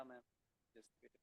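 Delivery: very faint and distant; almost lost on a bad line; barely audible. Real, not acted. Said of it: "Sir, can you be little louder?".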